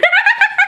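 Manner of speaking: very high, loud burst of laughter; shrieking laugh. Real, not acted. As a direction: squeal with laughter. Laughing at the card joke.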